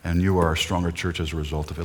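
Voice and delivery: ordinary talk, nothing marked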